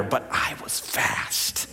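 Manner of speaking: breathy low whisper, slowed right down; hushed confession. Acted. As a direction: lean in and whisper it like a secret.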